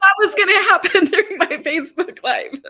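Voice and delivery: real laughter while speaking; laughing. Real, not acted. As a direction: laugh in disbelief. Laughing at her own surprise.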